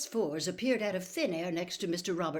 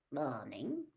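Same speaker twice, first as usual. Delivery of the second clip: lower, softer terse reply; gruff Muggle; 5 semitones lower, 6 dB quieter than usual. Acted. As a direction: mutter a curt greeting back.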